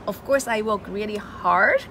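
ordinary talk

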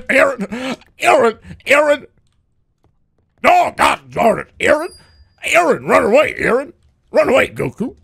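high, loud panicked yelling of Aaron; Hercule panicking. Retold or imitated. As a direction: shout in comic panic. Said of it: "Do Hercule".

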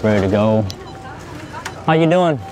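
ordinary delivery

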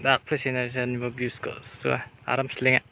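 ordinary delivery

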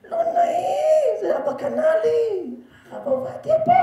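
very high, squeaky mock plea; hammed-up pleading. Pleading with the boy beside the rabbi, mock-embarrassed falsetto. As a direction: plead in a strained falsetto, stretching words. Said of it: "הייתי אומר לו".

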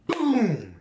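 a sudden high yelp of boom; crash sound effect. Retold or imitated. Retelling the T-bone crash, sudden high boom. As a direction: burst out with the crash noise.

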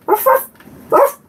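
high little toy-dog ruffs; playful dog bark. Pretend. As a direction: bark like a tiny puppy. Voices the toy dog barking.

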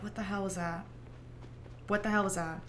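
soft, sleepy mumbled question; half asleep. Retold or imitated. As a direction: murmur it as if barely awake. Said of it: "I was, like, half asleep".

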